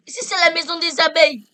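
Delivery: shouting. Real, not acted. Repeated claim, shouting.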